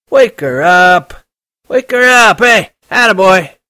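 put-on high, loud, slow drunken bellow; drunken shouting. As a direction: slur it loud into the phone, very drunk. Drunk Holden demanding.

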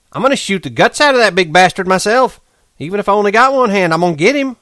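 high, loud, fast threat; Curly swearing to kill him, high and furious; raging vow. A narrator's reading. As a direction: spit out his revenge. Curly vowing revenge.

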